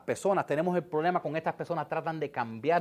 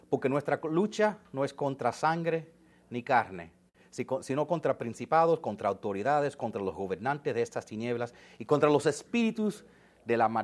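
scripture reading; 5 semitones lower than usual. Acted. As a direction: read the verse with reverent weight. Reads Ephesians aloud.

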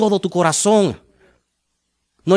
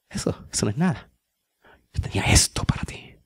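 soft, hushed, then whispered; God's gentle voice; 7 semitones lower, 10 dB quieter, faster than usual. Pretend. Pastor voices God answering a small prayer, softly whispered. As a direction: speak as God, intimate and reassuring. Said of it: "Y Dios dice".

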